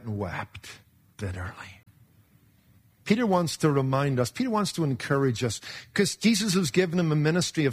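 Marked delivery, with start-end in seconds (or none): 0.0-1.8 sorrowful hush. Acted. Peter weeping, preacher drops voice.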